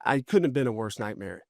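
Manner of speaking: ordinary delivery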